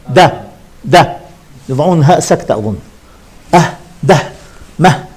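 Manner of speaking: ده and مه as bare sounds with a final h; letter sounds. Shown as a demonstration. Teacher demonstrates how letters are pronounced, short clipped sounds. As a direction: voice each letter sound with a pausal h. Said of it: "انطق الحرف".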